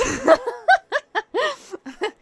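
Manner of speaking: squealing burst of laughter; high loud laugh. Real, not acted. Laughing at own joke.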